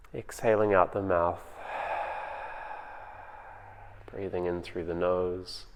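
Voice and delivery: calm, unhurried meditation voice with long pauses; guided meditation. Acted. Teacher guiding home listeners through their breathing, in a calm meditation voice. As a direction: ease them into the breath, gently.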